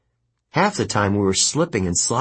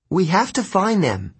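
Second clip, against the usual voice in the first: high-pitched urgent plea; insistent; 10 semitones higher than usual. A narrator's reading. Annabeth urges the group to find Grover, insistent. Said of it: "Annabeth insisted".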